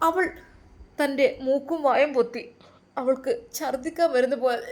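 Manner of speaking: usual speaking voice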